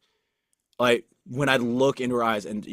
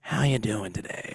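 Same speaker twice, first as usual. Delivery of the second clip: mock cautious coo; 3 semitones lower, 6 dB quieter, slower than usual. Others approach him gingerly.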